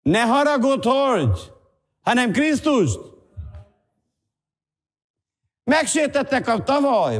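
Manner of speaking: exhorting preacher, voice pitched right up; impassioned preaching. Real, not acted. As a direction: drive the plea home from the pulpit. Preaches at full force.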